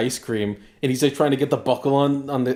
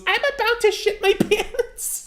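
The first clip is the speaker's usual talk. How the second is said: put-on much higher, slow whiny kid voice; child voice; slower than usual. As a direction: drag it out like a desperate little kid. Kid in retold video.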